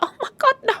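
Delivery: high shocked cry; high shocked oh my God; shocked gasp. Pretend. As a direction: recoil in disbelief, up high. Shocked at wig coming off.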